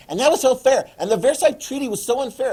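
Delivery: high, loud ranting complaint; loud acted outrage; Hitler. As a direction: protest the unfairness loudly, pitch way up. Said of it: "he's gonna be like".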